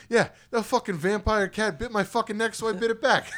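hammed-up drunken complaint; imitating drunken outrage. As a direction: mimic a drunk friend justifying himself loudly. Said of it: "the way he said it all drunken".

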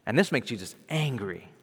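usual speaking voice

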